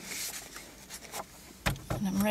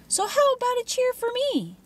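much higher, sing-song sneer; mock cheer demand. A narrator's reading. Tormentor taunting the cheerleader, sing-song mockery. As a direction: taunt her in a sweet mocking voice.

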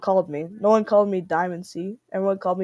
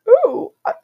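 very high, louder squeaky mock voice; imitating the Freddy voice; 7 dB louder than usual. Vlogger mocks old plush Freddy voice, very high and squeaky. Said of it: "I can't even recreate it".

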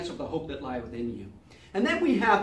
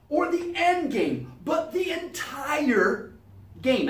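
rising, higher shout on entire; preaching build; 6 semitones higher, slower than usual. Acted. Preacher to congregation building to a higher shout. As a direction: drive it home to the entire game. Sermon climax on the word entire.

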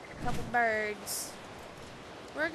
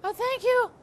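usual speaking voice

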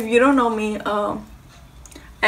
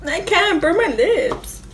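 laughing; 8 semitones higher, slower than usual. Real, not acted. Laughing over hot food.

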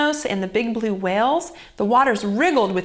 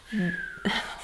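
sleepy sigh; 2 semitones lower, 9 dB quieter than usual. Acted. Theo falls asleep, sighs.

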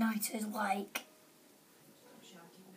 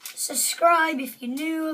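usual speaking voice

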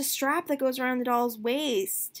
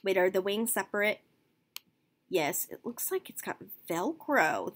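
ordinary talk, nothing marked

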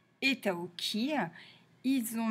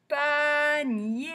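Panier stretched into two long, higher syllables; sing-song Pa-nier, each vowel held; drawn-out syllables; 5 semitones higher, 4 dB louder than usual. Shown as a demonstration. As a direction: sound out Panier slowly for learners. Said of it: "on va chercher tous les mots".

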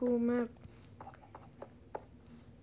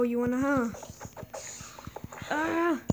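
ordinary talk

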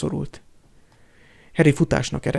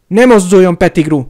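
shouted threat; 4 semitones higher, 8 dB louder than usual. A narrator's reading. Harry threatens Pettigrew with wand.

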